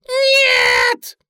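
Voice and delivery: very high, loud squeal; piercing drawn-out shriek; shrieking rabbit. A narrator's reading. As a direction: scream it in horror as the bunny. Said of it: "завизжал кролик".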